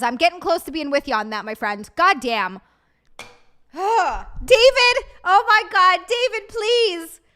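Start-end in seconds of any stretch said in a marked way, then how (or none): 3.7-7.2 loud, high mock-outraged calling out; calling out to a chatter, loud and pleading; exasperated shout. Real, not acted. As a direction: yell his name in exasperated disbelief. Reacting to chat member David.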